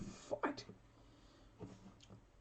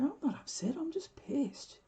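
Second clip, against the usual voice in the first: high, breathy whisper; a hint of a tipsy high whisper; tipsy protest; 10 semitones higher than usual. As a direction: lightly mimic a tipsy insistence, pitched up. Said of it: "I'm going".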